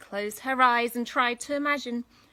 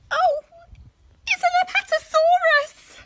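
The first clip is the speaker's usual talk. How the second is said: much higher, loud amazed cry; astonished; 13 dB louder than usual. Acted. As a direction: shout it, amazed. Katy astonished at dinosaur.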